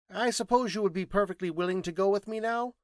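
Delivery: high-pitched scientist putting a question; insistent, high scientist's voice; Thinking Machine, matter-of-fact. Acted. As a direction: lift the whole question high.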